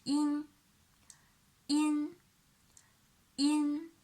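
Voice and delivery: "in" drilled for students; clear demo syllable. Shown as a demonstration. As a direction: model "in" with the tongue tip up. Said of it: "e with an alveolar nasal".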